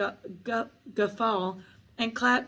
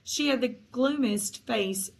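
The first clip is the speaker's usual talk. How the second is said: ordinary delivery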